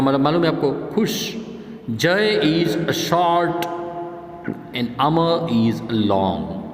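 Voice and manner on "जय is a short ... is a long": slow dictation. Shown as a demonstration. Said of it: "reads sentences slowly".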